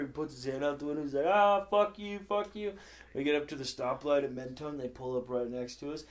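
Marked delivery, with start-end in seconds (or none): 1.3-2.7 higher mock yell, easing off; retold road rage. Driver with hand out the window, retold angry yell. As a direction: imitate cursing out of a window.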